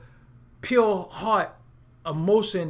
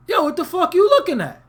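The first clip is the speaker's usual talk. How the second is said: high, loud confrontational yell; startled Brooklyn anger at a passing stare; angry street yell; 10 semitones higher, 5 dB louder than usual. Retold or imitated. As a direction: bark it at a stranger eyeballing you. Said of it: "I normally don't react like that".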